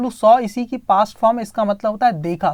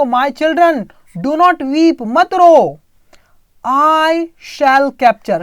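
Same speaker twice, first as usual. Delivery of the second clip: put-on loud, higher call to the children; deer's loud voice; 3 semitones higher, 5 dB louder, much slower than usual. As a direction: boom it out like the deer bluffing the tiger. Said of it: "तेज आवाज में अपना कुछ बोल रहा है". (तेज़ बदली हुई आवाज़ में)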